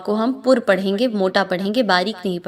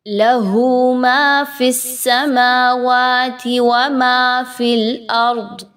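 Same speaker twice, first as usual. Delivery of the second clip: slow, melodic, louder recitation; Quran recitation; 3 semitones higher, 4 dB louder, slower than usual. Shown as a demonstration. Teacher recites the verse for the class, slow and melodic. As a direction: chant the verse, stretching the vowels. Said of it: "teacher reciting the verse".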